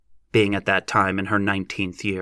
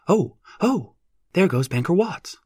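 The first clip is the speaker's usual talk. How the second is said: excited; 9 semitones higher than usual. A narrator's reading. Lossie spots the banker.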